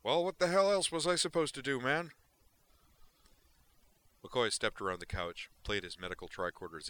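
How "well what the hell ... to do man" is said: much louder, higher, called from another room; Duke calling from kitchen. Acted. Duke calls from the kitchen, loud and defensive. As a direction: shout it back over your shoulder. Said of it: "his voice trailing back".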